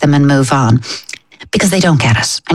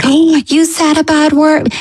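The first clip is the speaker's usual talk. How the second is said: much higher and slow, shocked overreaction; big dramatic fuss; 12 semitones higher than usual. Shown as a demonstration. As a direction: gasp it out like a scandalised parent. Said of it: "I wouldn't make such a big saga about".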